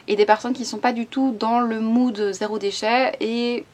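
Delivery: ordinary talk, nothing marked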